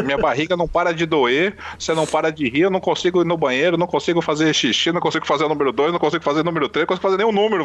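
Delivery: usual speaking voice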